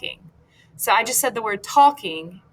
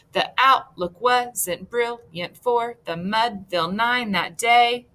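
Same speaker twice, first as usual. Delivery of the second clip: "The outlook wasn't brilliant" in separate two-syllable feet, second one stressed; even, chopped beat right through to "that day"; counted-out meter. Shown as a demonstration. Teacher counts iambic feet for students, marked weak-strong chant. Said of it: "So let's count 'em".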